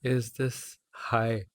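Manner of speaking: slow backwards echo. Pretend. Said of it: "I'm saying it backwards".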